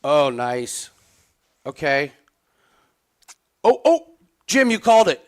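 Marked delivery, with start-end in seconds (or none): 3.6-5.2 higher, much louder burst of excitement; excited shout. Real, not acted. Watcher to Jim as his called choke lands, excited shout. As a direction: shout it as the prediction comes true. Predicted choke is happening live.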